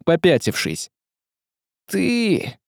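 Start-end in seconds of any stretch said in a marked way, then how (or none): 1.9-2.6 soft high exhaled word; breathed out. A narrator's reading. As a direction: let it out on the breath. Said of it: "выдохнул Василий".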